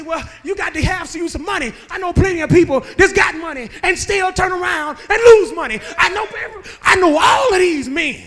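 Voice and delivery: ordinary talk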